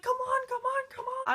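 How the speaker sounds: very high, loud urging; frantic hurrying. Retold or imitated. Girl at the top of the stairs rushing her friend, very high and frantic.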